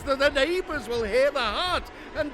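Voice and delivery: high, loud shrieking, building to a scream; frantic screaming. Acted. Narrator frantic at the pounding heart, screaming.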